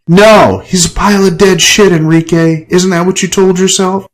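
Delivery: high, loud furious retort; screamed. Acted. Ava screaming at Enrique on the floor, furious. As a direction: scream the accusation at him. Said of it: "Ava screamed at me".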